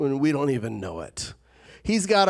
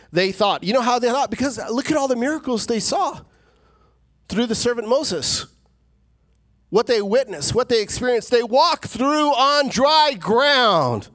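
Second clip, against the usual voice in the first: higher, louder, slowing at the peak; preaching at full force; 8 semitones higher, 4 dB louder than usual. Acted. Preacher to congregation about Israelites, climactic preaching. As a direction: build to a thundering climax.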